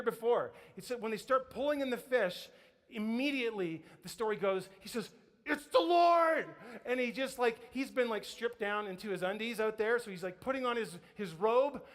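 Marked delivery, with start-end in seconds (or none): 5.4-6.5 high, loud, drawn-out cry; excited shout. Acted. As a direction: shout it out in sudden recognition. Acts Peter recognizing Jesus from boat.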